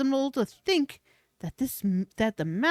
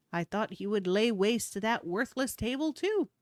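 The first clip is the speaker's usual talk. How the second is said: ordinary delivery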